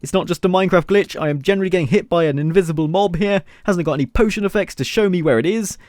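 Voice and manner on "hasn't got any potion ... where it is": ordinary talk, nothing marked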